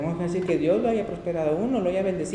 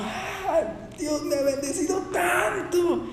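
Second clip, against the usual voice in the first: high, drawn-out gasp of delight; joyful gratitude; 12 semitones higher, much slower than usual. Acted. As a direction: marvel with joy at being so blessed, pitched way up. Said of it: "por eso era una alegría".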